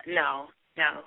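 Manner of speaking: usual speaking voice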